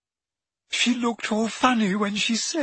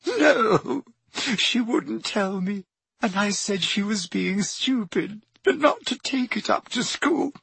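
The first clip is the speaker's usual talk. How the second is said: much higher, tearful, breaking voice; distraught girl; 11 semitones higher than usual. A narrator's reading. Leanne telling friends what happened, crying. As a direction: cry it out in panic.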